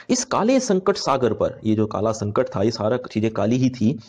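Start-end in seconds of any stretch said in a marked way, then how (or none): 0.1-1.6 slow, raised declaiming line; slow verse reading for students; poem recitation. Acted. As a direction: linger on काले as you recite.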